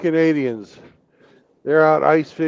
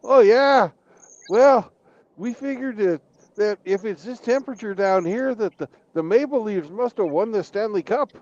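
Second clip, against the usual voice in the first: cheerful Canadians; 9 semitones higher than usual. Acted. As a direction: explain it like it's obvious, eh. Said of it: "they tell him".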